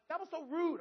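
high, loud indignant gripe; complaining. Retold or imitated. Husband to wife about the unthankful family, complaining. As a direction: re-enact an outraged moan, pitched well up. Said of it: "I'm complaining to Tracy".

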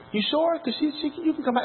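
high, soft imitation of a female boss; high-pitched, softly doubtful retold voice; woman boss's voice. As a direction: play the concerned boss, pitched well up and quiet. Voicing the boss in a story.

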